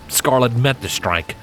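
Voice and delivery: ordinary delivery, nothing marked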